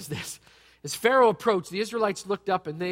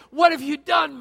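complaining shout; 8 semitones higher, 6 dB louder than usual. Retold or imitated. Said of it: "they're all saying".

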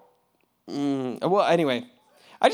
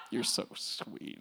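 soft fond reply; 8 semitones lower, 12 dB quieter, slower than usual. Retold or imitated. Fond reply to new believer.

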